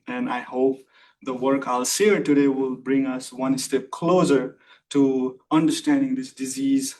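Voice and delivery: normal talking voice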